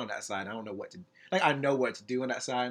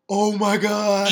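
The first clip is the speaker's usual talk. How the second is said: shocked gasp; 9 semitones higher, 8 dB louder, much slower than usual. Real, not acted. Shocked at explicit video.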